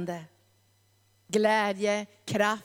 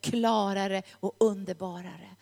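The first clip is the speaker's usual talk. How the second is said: ordinary delivery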